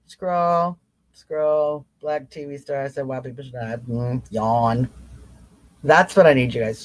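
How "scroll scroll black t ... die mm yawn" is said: low, much softer, utterly unbothered read; bored. Shown as a demonstration. Showing followers how to shrug off outrage news, low bored monotone. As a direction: shrug the headline off as if it bores you. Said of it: "you're gonna be like".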